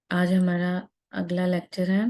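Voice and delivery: ordinary talk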